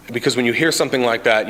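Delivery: ordinary talk, nothing marked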